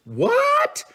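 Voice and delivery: high-pitched disbelieving yelp; mock shock. Fishing host pretending to be shocked by paddle tail flukes, mock shock. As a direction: squeal it in pretend astonishment.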